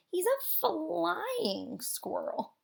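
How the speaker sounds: ordinary delivery, nothing marked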